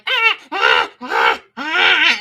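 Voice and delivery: high mock crying shouts; mock wailing. Mocking protesting women, loud fake wailing. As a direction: wail like a hysterical protester. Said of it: "It's like women like".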